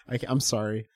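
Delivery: ordinary delivery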